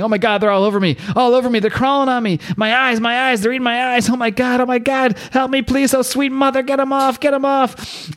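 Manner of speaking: high-pitched panicked cries at normal volume; mock screaming. Reader voices the man crawled over by visions, screaming. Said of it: "he's, he's screaming".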